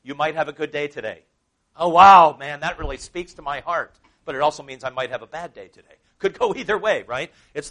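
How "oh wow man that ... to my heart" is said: loud mock-amazed gush; hammed-up delight. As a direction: play the wowed horoscope fan. Mocks horoscope reader.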